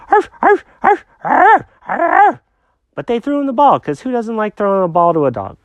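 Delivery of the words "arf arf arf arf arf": high yappy mock barks; dog barking. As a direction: bark like a small dog. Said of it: "all they heard was".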